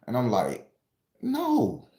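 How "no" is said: squeaky no. Retold or imitated. Refusing the cop's demand.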